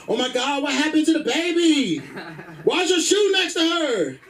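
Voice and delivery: high-pitched, alarmed girlfriend impression. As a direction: imitate an outraged girlfriend demanding answers.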